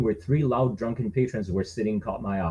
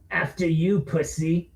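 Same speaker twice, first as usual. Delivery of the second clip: sneering bully; 6 semitones higher, slower than usual. Acted.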